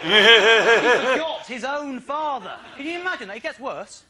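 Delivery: high, loud comic disbelief; incredulous outburst. Real, not acted. Comic to audience about forgotten father, incredulous. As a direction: marvel at it, voice pitched right up.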